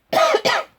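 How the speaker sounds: hard dry cough; clearing a tickly cough. Real, not acted. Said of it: "Excuse me".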